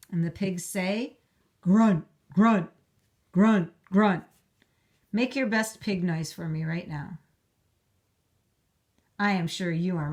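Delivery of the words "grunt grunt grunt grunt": loud grunting pig noises; pig grunts. Acted. Teacher voicing the twin pigs, loud grunts. Pigs voiced in class reading.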